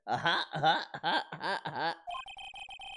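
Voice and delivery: put-on, drawn-out ha-ha laugh; mock laughing away to oneself; imitated annoying laugh. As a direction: imitate someone's irritating cackle, one ha after another. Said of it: "heard him laughing away".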